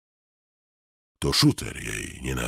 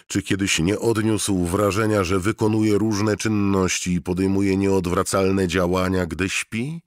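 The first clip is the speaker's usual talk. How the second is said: higher, louder, pressing question; anxious rising questioning; 7 semitones higher, 6 dB louder than usual. A narrator's reading. Narrator pressing Mort with a rising, louder question. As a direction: push the doubt up and up.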